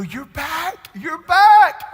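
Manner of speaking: high, loud cry of joyful disbelief; overjoyed disbelief. Retold or imitated. Pastor reliving his friend's return, overjoyed high cry. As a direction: shout it up high like you can't believe he's back. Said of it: "I go".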